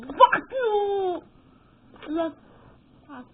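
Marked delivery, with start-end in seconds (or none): 0.0-1.2 angry yell. Real, not acted.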